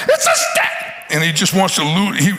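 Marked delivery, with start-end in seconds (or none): 0.1-0.7 very high indignant squeal; outraged mutant squeak. Acted. Preacher plays the giant outraged over a stick, squeaking. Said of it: "he's just outraged".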